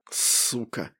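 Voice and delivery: low hiss through teeth; hissed curse. Acted. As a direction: spit it out under your breath. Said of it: "прошипел я сквозь зубы".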